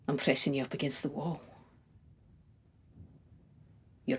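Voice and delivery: very soft, intimate near-whisper; hushed seduction. Acted. Lover imagining kisses with the beloved, hushed and intimate.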